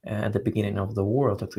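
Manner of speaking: usual speaking voice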